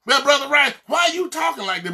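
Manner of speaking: mock church member questioning; mock questioner. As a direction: put on a startled churchgoer asking. Voicing a doubting questioner.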